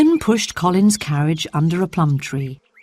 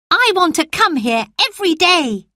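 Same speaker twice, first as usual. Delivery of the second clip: put-on a frail boy promising himself he'll live; sickly boy Colin; 12 semitones higher than usual.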